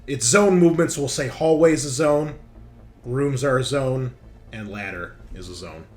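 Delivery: ordinary delivery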